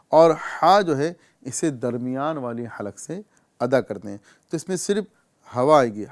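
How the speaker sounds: normal talking voice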